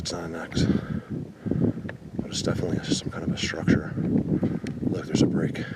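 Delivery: hushed, careful whisper. Real, not acted. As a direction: whisper so nothing hears you. Hushed while scouting the woods.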